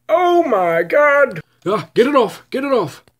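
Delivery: panicked high shrieks; shriek, spider on your back; screaming at a spider. Acted.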